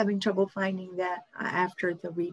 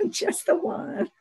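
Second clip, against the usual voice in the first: higher, drawn-out repeat through laughter; laughing; 10 semitones higher, slower than usual. Real, not acted. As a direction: repeat it slowly while breaking into laughter. Repeats answer while laughing.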